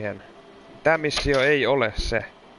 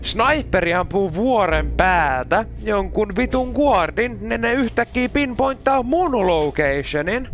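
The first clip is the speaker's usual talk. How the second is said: high, loud and slow exasperated complaint; frustrated rant; 8 semitones higher, 4 dB louder, slower than usual. Real, not acted. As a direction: vent at the game, drawing it out. Ranting at unfair game. (turhautunut, kova ja hidas purkaus)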